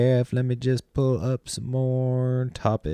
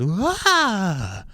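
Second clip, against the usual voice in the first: high startled yelp, mimicking the lady; mock startled squeal; 10 semitones higher than usual. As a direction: imitate her jumpy shriek.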